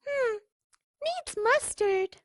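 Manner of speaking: high, slow little duckling voice; a tiny critic tasting; satisfied duckling. Acted.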